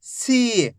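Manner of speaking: सी with a long, held ee; teacher drawing out सी; drawn-out syllable. Shown as a demonstration. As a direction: stretch the vowel in सी for learners. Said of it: "खींच खींच के पढ़ना है".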